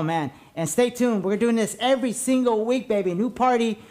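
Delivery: ordinary delivery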